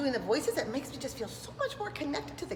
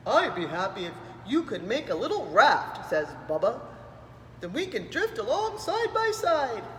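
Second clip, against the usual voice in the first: a hint of a big gentle whale; lightly voiced whale. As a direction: talk like a friendly whale. Bubba the whale speaks.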